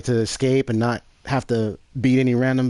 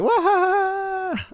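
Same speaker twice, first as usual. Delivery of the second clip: high cackling mock laugh; mock villain laugh; much slower than usual. Streamer imitating the game's strange laughing sound, high cackle. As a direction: cackle like a cartoon baddie. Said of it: "It's like".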